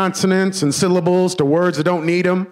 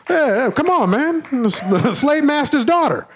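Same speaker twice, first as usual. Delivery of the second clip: much higher, playful crowd tease; mock hype; 9 semitones higher than usual. Real, not acted. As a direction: sell it like a big reveal.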